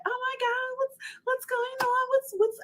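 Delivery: very high-pitched mock panic; mimicked clueless friend. Driver mimicking her friend asking what is happening, high squeal. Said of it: "my friend, she was like".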